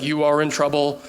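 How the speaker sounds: low robotic phone message; robocall voice. Retold or imitated. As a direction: mimic a stern recorded message. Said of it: "the automated message".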